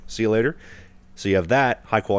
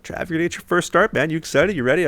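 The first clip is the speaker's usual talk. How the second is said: mock excited teammates, pitched much higher; hyped teammates; 9 semitones higher than usual. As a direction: mimic the boys pumping him up. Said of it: "all the boys after the game were like".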